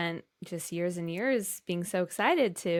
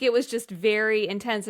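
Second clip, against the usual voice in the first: ordinary talk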